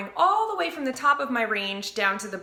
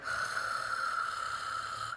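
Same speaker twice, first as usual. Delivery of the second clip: yoga fire breathing; 10 dB quieter than usual. Shown as a demonstration. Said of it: "like, a yoga fire breathing sound".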